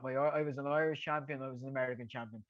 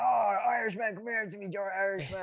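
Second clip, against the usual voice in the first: George Foreman voice; 6 semitones higher, 4 dB louder than usual. Retold or imitated. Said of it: "I said".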